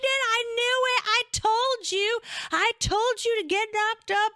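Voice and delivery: high, loud gloating squeal; imitating mom crowing "I told you"; mom's triumphant shriek. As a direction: mom gloats she predicted it. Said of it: "And she's like".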